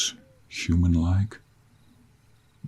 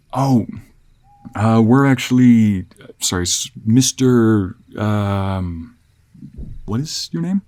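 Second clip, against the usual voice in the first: higher, hesitant traveling fiancé; flustered traveler; 4 semitones higher, 5 dB louder, slower than usual. Acted. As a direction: stammer politely through an explanation to a stranger. Voices the traveler asking a stranger's name.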